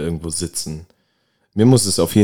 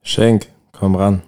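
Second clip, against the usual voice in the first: calling out loud; 9 dB louder than usual. Real, not acted. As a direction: shout it across the room. Calling out to a rapper.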